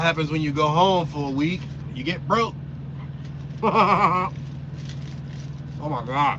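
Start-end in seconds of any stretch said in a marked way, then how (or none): 2.6-5.8 breathy laugh. Real, not acted. Laughing at going broke.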